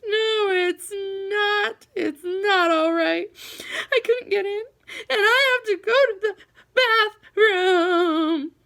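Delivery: high, slow wailing through tears; a little boy crying hard, words dragged out; sobbing child. A narrator's reading. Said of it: "burst into fresh tears".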